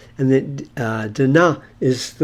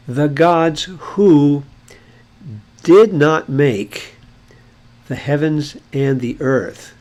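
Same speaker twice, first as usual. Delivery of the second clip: the gods who spoken very slowly and louder, each word spaced out; slow, deliberate translation; 2 semitones higher, 6 dB louder, much slower than usual. Shown as a demonstration. Teacher reading the translated verse to the class, slow and emphatic. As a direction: lay out the finished translation word by word, slowly and firmly. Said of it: "So this would be".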